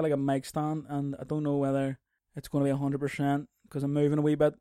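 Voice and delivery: usual speaking voice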